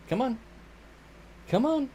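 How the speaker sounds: high, soft you're so needy cooed at the dog. Real, not acted. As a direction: coo at the needy puppy. Calling pet.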